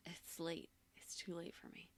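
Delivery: tired late-night whisper. Real, not acted. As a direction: whisper it, worn out.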